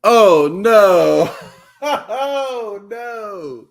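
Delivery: loud, high, drawn-out dismay; laughing, long-stretched cry of sympathy; dismayed laughing reaction. Real, not acted. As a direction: wail it in amused horror. Listener reacts to ruined heirloom.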